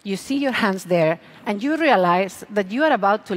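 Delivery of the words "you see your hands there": ordinary talk, nothing marked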